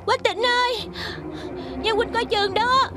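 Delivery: high-pitched pleading calls; feigned cries for help. Pretend. Girl testing her companion with fake danger, high cries for help. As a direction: call out as if trapped, voice way up. Calling for rescue as a trick.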